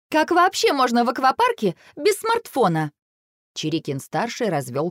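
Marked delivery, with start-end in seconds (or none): 0.1-2.9 high, indignant daughter. A narrator's reading. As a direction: object as if it were unthinkable. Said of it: "возмутилась та". (возмущённо)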